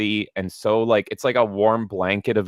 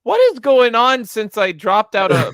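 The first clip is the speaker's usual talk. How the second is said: loud, high-pitched disbelief; baffled outburst; 10 semitones higher, 7 dB louder than usual. Real, not acted. Lapsed Marvel fan reacting to a bizarre plot, baffled outburst. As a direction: exclaim in real bewilderment, voice jumping up.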